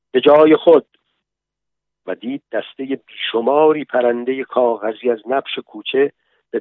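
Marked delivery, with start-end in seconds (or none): 0.1-0.9 loud, higher-pitched military order; commanding call. A narrator's reading. Sergeant ordering soldiers to their places, commanding call. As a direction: call the troops to order, raised voice.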